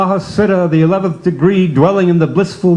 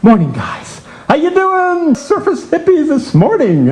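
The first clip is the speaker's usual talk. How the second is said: bright, high hello to the ward; cheery high greeting; 11 semitones higher than usual. Acted. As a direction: greet the patients with a high sing-song. Cheery greeting to fellow patients.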